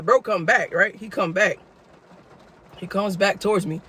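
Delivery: ordinary talk, nothing marked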